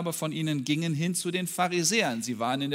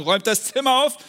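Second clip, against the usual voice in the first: commanding father; 7 semitones higher, 8 dB louder, faster than usual. Acted. Father commanding his kids.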